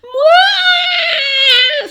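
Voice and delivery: put-on very high loud baby cry; high screaming baby; baby wail. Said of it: "se met à hurler l'enfant".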